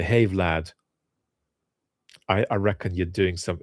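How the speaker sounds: ordinary talk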